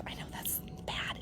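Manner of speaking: whispered, a little naughty; guilty whisper. Real, not acted. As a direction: hush it like a guilty secret. Confessing naughty idea.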